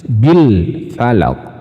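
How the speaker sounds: very slow bil falaq, lam clear; slow model. Shown as a demonstration. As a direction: keep the l of bil distinct. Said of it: "harus dibaca jelas".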